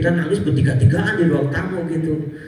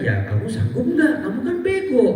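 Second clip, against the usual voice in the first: mocking teacher; 12 semitones higher than usual. Imagined teacher mocking him.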